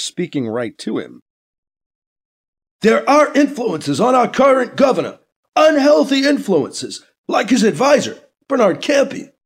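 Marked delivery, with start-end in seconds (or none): 2.8-9.3 high, loud accusing shout; ranting attack. Acted. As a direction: hurl the accusation at the camera. Politician attacking on TV.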